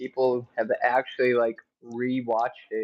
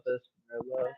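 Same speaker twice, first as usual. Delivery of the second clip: softer, muttered repeat; quiet echo; 8 dB quieter than usual. Real, not acted. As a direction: say it again under your breath.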